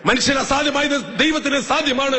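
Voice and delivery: put-on child quoting scripture. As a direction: recite the verse like a keen child. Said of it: "quotation വച്ചിട്ടാ പറയണേ".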